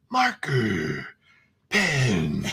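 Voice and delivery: deep, slow movie trailer voice; horror trailer voice. Shown as a demonstration. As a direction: growl it like a trailer narrator. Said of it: "trailer voice".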